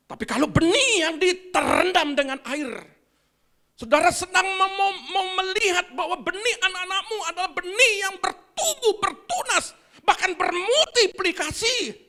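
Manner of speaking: loud joyful sermon climax with laughter; fervent preaching. Acted. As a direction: lift the church up with joy. Preaching at full force.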